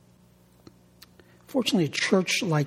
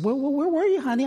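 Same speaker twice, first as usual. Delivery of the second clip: high, sweet wife voice; wife's voice; 10 semitones higher, faster than usual. Retold or imitated. Pastor voicing his wife asking where he went. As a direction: lift the pitch up as the wife asking.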